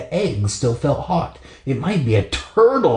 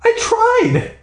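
high, wailing cry; Brandy wailing back at her dad. Acted. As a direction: wail it like a hurt kid. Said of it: "Brandy wailed".

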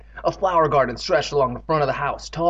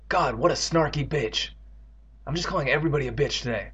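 plain reading voice

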